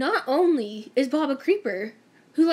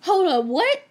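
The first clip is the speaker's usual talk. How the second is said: higher, louder startled "what?"; shocked disbelief; 6 semitones higher, 4 dB louder, slower than usual. Retold or imitated. She hears he talked about her, startled and higher. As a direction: stop them short in disbelief. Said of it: "And I was like".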